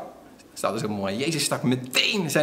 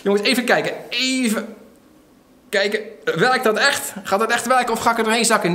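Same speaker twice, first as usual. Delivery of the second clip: mock hesitation; 5 semitones higher than usual. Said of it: "was niet zo van".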